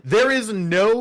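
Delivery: indignant protest. Real, not acted. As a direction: protest loudly at the accusation. Mock defence after being teased.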